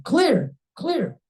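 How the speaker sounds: high, sharp call; defibrillator warning. Acted. Speaker acting out defibrillator moment from her speech, urgent call. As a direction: announce it like an emergency medic.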